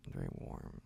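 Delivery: breathy whisper, very close. Acted. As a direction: whisper it right in the ear. Repeats as whisper.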